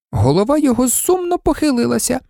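plain reading voice

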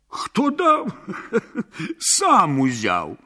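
high incredulous echo, then a chuckle; amused. A narrator's reading. Pekale tells villagers who gave him the herd, amused.

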